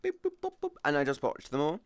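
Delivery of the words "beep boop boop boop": high chirpy beep-boop sounds; beep boop noises. Pretend. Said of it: "just went".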